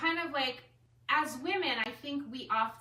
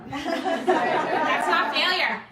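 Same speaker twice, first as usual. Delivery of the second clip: laughing; 5 semitones higher, 12 dB louder, much slower than usual. Retold or imitated. Laughing retort to Rita's line.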